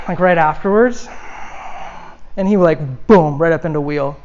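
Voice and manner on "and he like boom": ordinary talk, nothing marked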